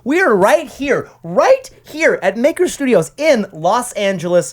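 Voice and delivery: high, loud show-host voice; big hammed-up podcast welcome; announcer intro. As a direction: open the show like a radio host.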